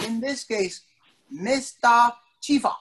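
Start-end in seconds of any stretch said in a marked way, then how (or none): none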